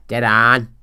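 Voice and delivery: DEAD ON as a loud Belfast noise, ON drawn towards "aan"; exaggerated Belfast "dead on"; Belfast accent. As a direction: mimic Northern Irish "dead on" with a stretched open vowel. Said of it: "all you'd hear is this noise".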